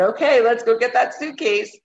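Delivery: brisk and upbeat; rally yourself into action; a touch of cheerful resolve; determined. Retold or imitated. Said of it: "And then I said".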